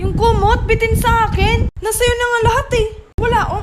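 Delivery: loud, high sibling gripe; angry complaint. Acted. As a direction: raise your voice in indignant protest. Siblings quarrel over a shared blanket.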